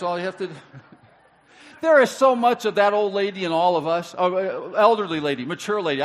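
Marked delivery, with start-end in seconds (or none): 1.8-6.0 loud amused aside. Real, not acted. Laughing loud aside after joke.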